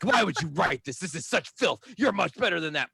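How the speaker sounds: a hammed-up outraged mom; mom freaking out. Mom finds lyrics and scolds her son, hammed-up outraged. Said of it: "freaking out on me".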